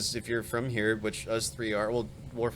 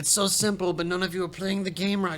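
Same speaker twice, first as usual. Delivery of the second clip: put-on lecturing in a high, loud German accent; mock German accent, scolding; 9 semitones higher, 4 dB louder than usual. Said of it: "your German accent".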